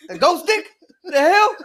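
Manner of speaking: high, loud, laughing question; laughing in disbelief. Real, not acted. Friends crack up at a misheard line, laughing hard. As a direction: crack up at the odd phrase. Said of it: "laughing at mishearing".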